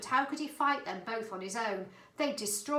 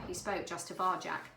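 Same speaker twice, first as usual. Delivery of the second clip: ordinary delivery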